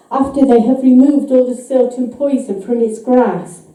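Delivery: usual speaking voice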